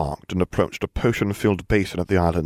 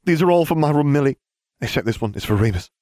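eager, high-pitched gush of praise; 8 semitones higher than usual. A narrator's reading. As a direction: gush gratefully at your best friend. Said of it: "he gushed".

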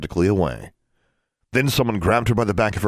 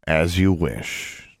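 slow, measured giving in; slow concession; much slower than usual. Acted. Father finally giving in to his son, slow. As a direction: let it out slowly, deciding. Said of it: "he said at last".

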